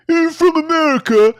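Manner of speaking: mocking a stranger who bluntly guesses your country; blunt mock asker. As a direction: imitate someone bluntly labelling you. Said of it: "There's the people who say".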